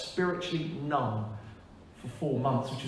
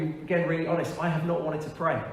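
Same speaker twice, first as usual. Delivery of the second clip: usual speaking voice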